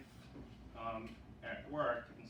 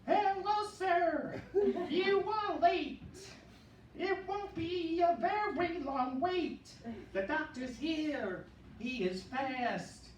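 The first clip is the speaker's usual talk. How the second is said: much higher, louder, slow belting Broadway voice; 8 dB louder, slower than usual. Retold or imitated. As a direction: belt it like a Broadway diva. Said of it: "the [name] dialect".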